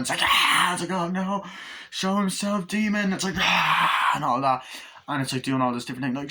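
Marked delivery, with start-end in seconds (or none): 0.2-0.7 loud, high imitated scream; sudden pitched-up "aah"; mock scream. Demon appears in retold scene. 0.9-3.1 high, dramatic character voice; pitched-up horror-film acting; mock alarmed summoning. Acted summoning of demon. 3.4-4.1 loud, high imitated scream; raised, pitched-up cry; mock scream. Demon scene acted out.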